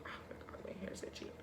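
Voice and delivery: breathy murmured aside to herself; whispered aside. Real, not acted. Muttering to viewers about itchy hair, whispered.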